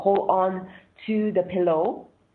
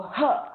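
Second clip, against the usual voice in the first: single pushed-out huh; forceful breath grunt; 6 semitones higher than usual. Shown as a demonstration. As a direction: huh forced out as you lean forward. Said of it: "then you go".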